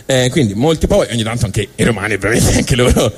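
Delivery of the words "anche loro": usual speaking voice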